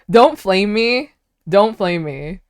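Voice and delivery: ordinary talk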